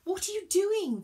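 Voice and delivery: loud, high indignant question; high-pitched indignant challenge; demanding. A narrator's reading. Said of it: "demanded Virgo".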